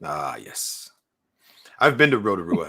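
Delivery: ordinary delivery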